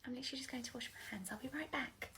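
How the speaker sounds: hushed unvoiced whisper. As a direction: slip away whispering it. Sneaking off to the loo.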